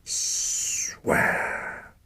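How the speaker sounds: sharp whispered hiss. Acted. Dying father hisses at his son to swear, in a whispered hiss. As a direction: hiss the command. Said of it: "hissed his father".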